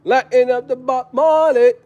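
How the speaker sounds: high, singsong Jamaican patois; mock Jamaican accent. Vlogger saying how he'd be in there, mock Jamaican accent. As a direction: call it out in playful Jamaican. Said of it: "I'm gonna be in there like".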